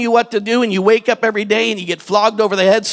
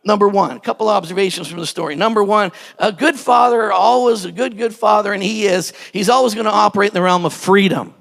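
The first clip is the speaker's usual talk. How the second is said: usual speaking voice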